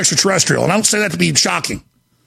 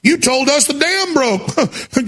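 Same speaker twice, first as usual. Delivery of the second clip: mocking quote; 5 semitones higher than usual. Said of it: "And they go".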